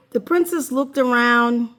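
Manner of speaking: plain reading voice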